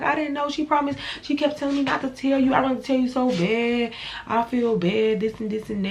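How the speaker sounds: high pleading friend voice; imitating the best friend's guilty excuses; mimicked friend. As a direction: whine the excuse back up high.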